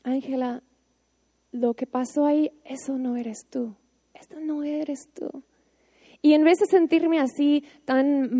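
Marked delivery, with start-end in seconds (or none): 0.0-5.4 much softer, gentle reassurance; God softly telling Ángela her mistake is not her, much softer; God's tender voice. Retold or imitated. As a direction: hush it like a loving father consoling. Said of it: "que Dios me decía".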